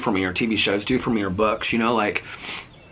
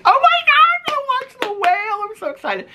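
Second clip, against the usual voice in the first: excited squeal; 3 dB louder than usual. Real, not acted. Thrilled about The Whale.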